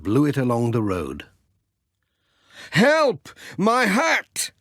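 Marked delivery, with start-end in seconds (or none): 2.7-4.5 startled shout after a runaway hat; cry for help. Acted. Hat blown away by wind.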